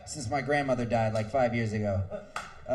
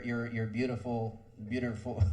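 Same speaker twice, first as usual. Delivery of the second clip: usual speaking voice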